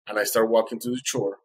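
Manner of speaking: usual speaking voice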